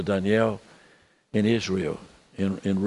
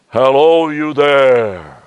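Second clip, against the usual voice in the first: loud, drawn-out mock voice from heaven; hammed-up God calling down, long held vowels; booming voice of God; 7 dB louder, much slower than usual. Preacher mocks how people imagine God speaking, booming. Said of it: "God never from heaven says".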